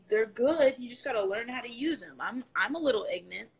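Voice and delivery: normal talking voice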